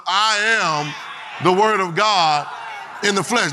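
the word of God drawn out slow and declared; slow, firm affirmation. Shown as a demonstration. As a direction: declare "word" with full weight, slowly. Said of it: "Next time the problems show up and say".

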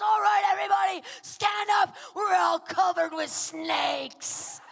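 high, loud call to the crowd; rallying shout. Retold or imitated. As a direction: shout it to a congregation, drawing out the end. Woman commanding crowd from microphone.

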